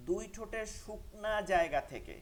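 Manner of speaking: slow, high, spelled out: দুই ঠোঁটের শুকনা জায়গা থেকে; drawn out for students, stressing জায়গা; slow teaching emphasis. Shown as a demonstration. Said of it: "মিম". (ধীরে, জোর দিয়ে শেখানোর মতো)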